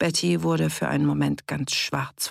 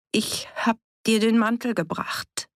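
put-on higher, hesitant child's line; mild stammer; 6 semitones higher than usual. A narrator's reading. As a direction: stammer it lightly as Betty. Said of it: "stammelte Betty".

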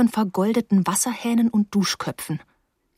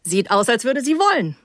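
higher, amused character voice with a hint of laughter; hearty laughter; 4 semitones higher than usual. A narrator's reading. Jack teasing her about the drink, lightly laughing. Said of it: "mit herzlichem Lachen".

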